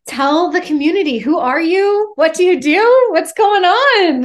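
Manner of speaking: host excitedly asking the guest to introduce herself, high and loud; excited prompt. Real, not acted. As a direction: fire it off like a hype host inviting the guest in.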